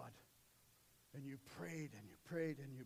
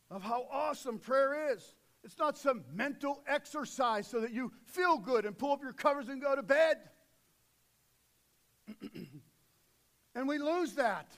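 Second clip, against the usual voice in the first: loud, driving sermon delivery; booming pulpit voice; full-force preaching; 7 semitones higher, 16 dB louder than usual. Acted. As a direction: preach it to the back row.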